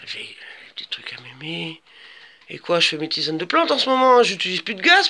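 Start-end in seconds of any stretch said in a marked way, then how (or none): 0.1-1.8 slow hushed whisper. Real, not acted. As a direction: whisper it as a secret. Whispered aside.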